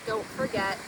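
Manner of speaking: ordinary delivery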